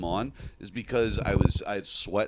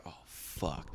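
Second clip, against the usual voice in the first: whispered dread; 6 semitones lower, 17 dB quieter than usual. Retold or imitated. Caught with acid in pocket.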